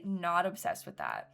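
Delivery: ordinary delivery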